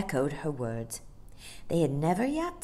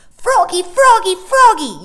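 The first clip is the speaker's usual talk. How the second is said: high, loud repeated cheer; excited yell; 16 dB louder than usual. A narrator's reading. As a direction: yell for your racer to win. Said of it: "yelled Michael".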